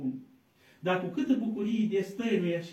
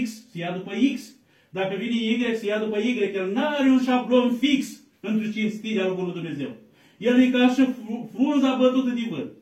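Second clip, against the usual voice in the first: louder, emphatic preaching, building on each example; rising sermon fervour; 3 semitones higher, 6 dB louder than usual. Acted. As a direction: press the point on the doubting man, hammering each case.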